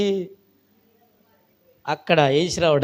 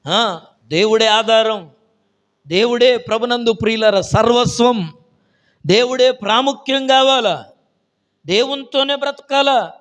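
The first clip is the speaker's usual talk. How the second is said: fervent preaching; 6 semitones higher, 6 dB louder than usual. Acted. Preacher proclaiming God to the church, loud and fervent. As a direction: proclaim each line louder and higher.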